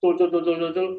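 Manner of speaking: high, rapid mock gabble; rapid rattling. Shown as a demonstration. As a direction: spill the syllables out like scattered corn. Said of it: "Grontol kutah menika oleh ngomong".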